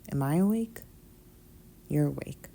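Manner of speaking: ordinary delivery, nothing marked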